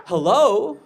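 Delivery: high, very loud questioning hello; hammed-up shouted greeting; loud phone hello. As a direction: answer the phone far too loudly. Woman answering phone, called out.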